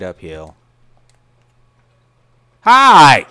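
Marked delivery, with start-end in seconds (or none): 2.6-3.3 loud. Acted. Jump scare greeting as killer.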